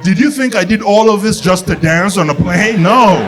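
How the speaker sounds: loud, high, incredulous outburst; hammed-up outraged shouting; indignant comeback.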